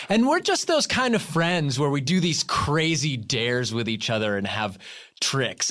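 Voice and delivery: ordinary talk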